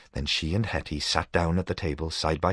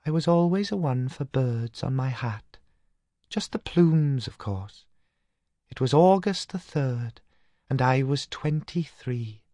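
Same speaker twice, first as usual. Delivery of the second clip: put-on dreamy young Katinka; clear new voice; 9 semitones higher than usual. A narrator's reading. Dead sister Katinka describes her hat, clear and high. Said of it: "the clear new voice".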